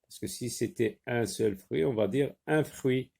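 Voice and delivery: usual speaking voice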